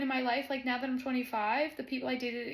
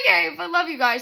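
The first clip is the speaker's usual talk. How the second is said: teary laugh, louder; laughing through tears; 9 semitones higher, 11 dB louder than usual. Real, not acted. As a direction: laugh and cry at once. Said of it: "the reason I'm crying".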